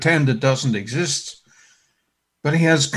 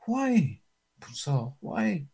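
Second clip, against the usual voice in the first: soft, pleading and gentle; compassionate; 3 semitones higher, 6 dB quieter than usual. Acted. The Lord gently pleading with Saul, soft and compassionate. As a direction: plead softly, full of care. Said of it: "compassion that's in the master's voice".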